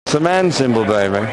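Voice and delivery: put-on camp sixties London accent; Austin Powers voice.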